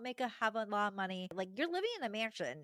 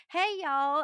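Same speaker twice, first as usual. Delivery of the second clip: higher, louder mock American twang; American accent; 8 semitones higher, 8 dB louder than usual. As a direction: burst in with a hammed-up American accent. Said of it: "American accent".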